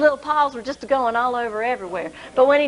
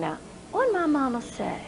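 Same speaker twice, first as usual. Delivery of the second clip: quietly thinking aloud; 8 dB quieter than usual. Acted. Boy muttering to himself.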